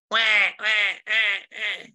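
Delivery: high mock wailing, "wah" by "wah"; cartoonish baby wail; imitated baby cry. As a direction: imitate a newborn crying in short bursts. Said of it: "a kid doesn't come out".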